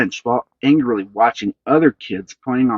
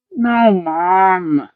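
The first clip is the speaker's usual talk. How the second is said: groaning; 8 semitones higher, 4 dB louder than usual. A narrator's reading. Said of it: "groaning more".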